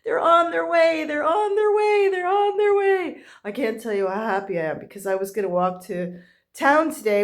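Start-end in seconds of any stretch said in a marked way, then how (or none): 1.1-3.2 excited sing-song. Real, not acted. Repeated joyful news.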